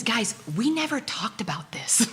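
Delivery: conspiratorial whisper to the audience; laughing whisper. Real, not acted. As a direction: lean in and whisper it, half laughing. Aside to the audience.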